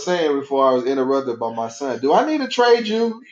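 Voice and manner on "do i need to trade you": mock threat. Mock threat to his son.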